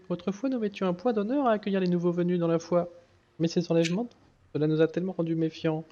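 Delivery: very high voice reading a pleading priestess; high-pitched NPC voice. Acted.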